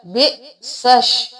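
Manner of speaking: बे सश run together, slow; bisash joined. Shown as a demonstration. Teacher slowly showing the joined syllables. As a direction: say बे सश without the alif and lam. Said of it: "अलिफ और लाम को नहीं पढ़ेंगे".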